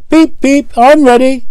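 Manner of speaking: high, bright engine voice with toots; cheerful Edward. A narrator's reading. Edward ready behind the brake van, eager and cheerful. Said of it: "said Edward".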